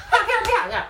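loud high laughing slap noises; slap sounds. Retold or imitated. Retelling mom's slaps to friends, loud laughing slap noises. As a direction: smack it out, laughing.

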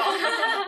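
high burst of laughter. Real, not acted. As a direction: laugh out loud. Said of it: "都在憋笑".

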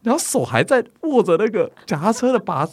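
normal talking voice

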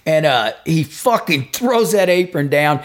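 ordinary delivery

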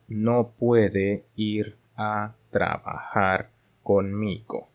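very slow, word-by-word Spanish reading; slow dictation pace for learners. Shown as a demonstration. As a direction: read it slowly with pauses between words for learners to repeat. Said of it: "我就会用正常速度，".

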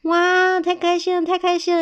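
high, loud delighted cheer; overjoyed. Acted. Children receiving Santa's gifts, overjoyed. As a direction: squeal happily over presents.

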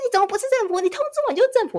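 hammed-up indignant outburst in a high voice; imitating an angry yell. Husband insisting the caller is the government, high mock yell. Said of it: "他大概吼了大概十分钟吧".

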